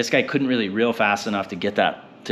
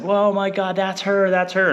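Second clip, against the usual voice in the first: much higher, excited disbelief; freaking out; 11 semitones higher than usual. Retold or imitated. Buddy recognising the same marlin, high excited shouting. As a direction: blurt it out, eyes locked on the fish. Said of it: "Like, he's freaking out".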